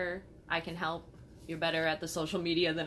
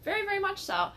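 sarcastic; 13 semitones higher, faster than usual. Acted. Sarcastic emphatic agreement.

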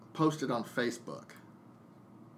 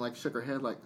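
usual speaking voice

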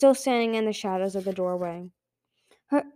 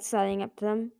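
plain reading voice

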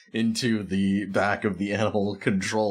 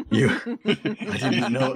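minion voice; 5 semitones higher than usual. Acted. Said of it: "adapted your latest minion's speaking mannerisms".

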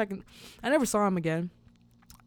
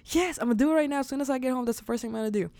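excited promise; 6 semitones higher, much faster than usual. Retold or imitated. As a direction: promise it right away, buzzing. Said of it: "I got so excited".

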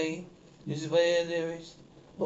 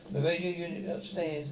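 ordinary talk

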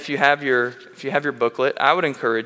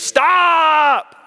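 a sudden, much higher, much louder "Stop!"; sudden scream; 9 dB louder than usual. Retold or imitated. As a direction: snap and scream it at a squirming child. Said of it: "I screamed in his face".

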